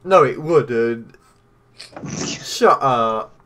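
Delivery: loud, slow, indignant; loud protest. Real, not acted. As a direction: shout down the argument. Heated argument about speed of light.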